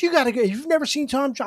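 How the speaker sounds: urging friend. Retold or imitated. Friend pushing him to see [name], urging. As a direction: lightly imitate an insistent, amazed friend.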